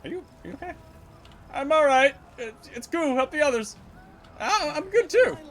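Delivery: high-pitched put-on soldier voices; high, halting character voice; voiced game recruits.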